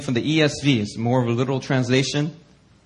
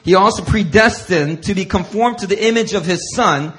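preaching scripture; 6 semitones higher, 5 dB louder than usual. Acted. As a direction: proclaim each word to the church. Reads scripture aloud.

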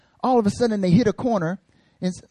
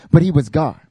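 ordinary delivery

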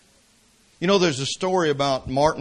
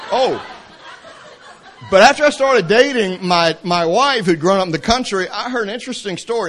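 laughing; 5 semitones higher, 5 dB louder than usual. Real, not acted. Laughing at his own tangent.

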